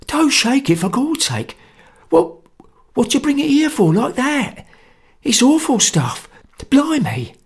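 high, strained voice of a scared conspirator; hoarse, frightened whisper. A narrator's reading. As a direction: plead hoarsely, in a panic. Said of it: "he whispered hoarsely".

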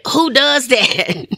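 high, drawn-out disbelief; slow it down and pitch it up, baffled; incredulous. Retold or imitated. Woman reacting to being sent to church, incredulous.

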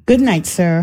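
ordinary talk

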